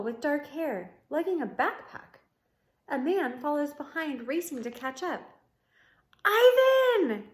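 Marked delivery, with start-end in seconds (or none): 6.2-7.3 high, loud yell across the distance. Acted. Girl yells to Ivan across the moat, very loud and high. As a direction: call out his name at full voice. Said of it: "the girl yells".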